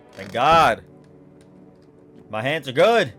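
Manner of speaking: loud burst of relief; relieved. Real, not acted. Relief after checking hands.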